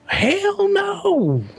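high squealed hell no, his twang; Sam Miller's intonation. Retold or imitated. Copying Sam Miller's catchphrase for the podcast guys, high and squealed. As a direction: hit it up high like Sam does. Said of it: "Sam just has those intonations".

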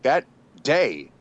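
usual speaking voice

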